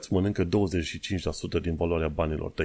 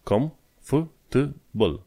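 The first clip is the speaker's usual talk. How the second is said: slow, higher "comfortable", split "com-f-t-bul"; syllable by syllable; 7 semitones higher, much slower than usual. Shown as a demonstration. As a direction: break "comfortable" into slow teaching chunks. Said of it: "ai sărit peste câteva".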